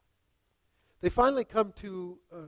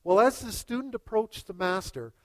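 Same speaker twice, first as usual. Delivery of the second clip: normal talking voice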